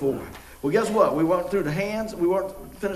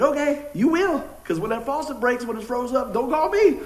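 high-pitched imitation of a father's warning; mock warning dad; 6 semitones higher than usual. Dad warns son in retold exchange.

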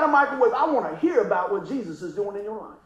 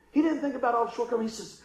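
ordinary delivery

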